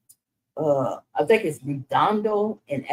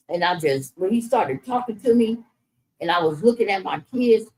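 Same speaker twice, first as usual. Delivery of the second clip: ordinary delivery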